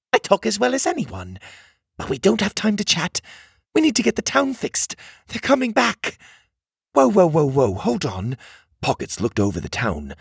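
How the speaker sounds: put-on high, brisk little mechanical girl; a small bossy girl urging them on; bossy cog girl. A narrator's reading. Cog girl warning strangers about Tuga, high and urgent.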